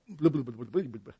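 mock volcano babble; gushing babble. Preacher mimicking people who are like volcanoes, babbling. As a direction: burble it out in a mushy stream. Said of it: "it's all coming out".

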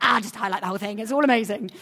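excited exclamation, half-joking; mock gushing. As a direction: act out giving up and loving every verse. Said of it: "and then you're like".

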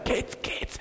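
faint strained croak, barely getting out; croaking, lost voice. Retold or imitated. Cockerel trying to wake his kids, voiceless croak. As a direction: imitate a cockerel who has lost his voice. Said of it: "and then he's croaking".

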